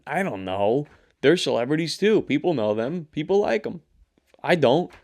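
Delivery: ordinary talk, nothing marked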